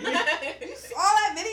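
imitating high, loud, drawn-out amazement; amazed reaction. Baker imitating a rapper stunned she saw his video, amazed. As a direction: retell his surprise high and loud, stretching it out. Retold rapper's delighted surprise.

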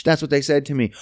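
usual speaking voice

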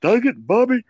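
exasperated southern dad scolding; Hank Hill impression.

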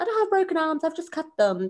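incredulous protest. Retold or imitated. Retelling her reply to paramedics.

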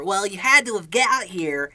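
high nasal know-it-all nerd. Retold or imitated. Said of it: "with the most highest nasally voice".

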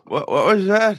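high, put-on elderly voice; hard-of-hearing old-timer. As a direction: play an old man who missed what was said. Plays hard-of-hearing old man.

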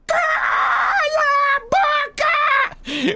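very high, drawn-out shout; very high dragged-out yell; jingle shout. Acted. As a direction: stretch it into a shrieking jingle.

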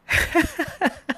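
breathy high laugh-sob, hammed up. Mocking herself with a laugh-sob. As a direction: laugh as if about to cry. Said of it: "I'm like".

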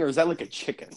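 ordinary delivery

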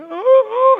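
loud high crying oh, oh; sobbing. Retold or imitated. Son breaking down in his father's arms, sobbing. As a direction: wail it out, overcome with tears.

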